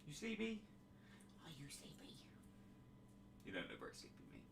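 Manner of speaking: softly asking the dog if he's tired; cooing at the dog. Real, not acted. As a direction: coo gently at a sleepy pup.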